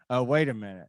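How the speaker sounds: usual speaking voice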